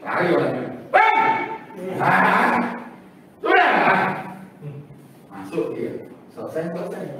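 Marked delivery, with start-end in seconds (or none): 0.9-1.2 high, loud one-word shout; Batak shout. Retold or imitated. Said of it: "Orang Batak dia ngomong". 2.0-4.1 startled reply. Retold or imitated.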